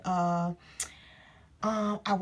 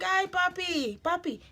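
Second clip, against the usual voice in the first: higher, put-on Spanish accent; imitated Spanish accent; 9 semitones higher, 3 dB louder than usual. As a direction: greet them in a mock Spanish accent. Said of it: "but that's how they talk".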